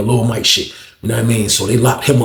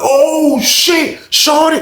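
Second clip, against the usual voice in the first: high-pitched, astonished holler; retold excited calling; 14 semitones higher, much slower than usual. Men coming upstairs with news of a knockout, retold excited calling. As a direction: call out the shock in a high voice.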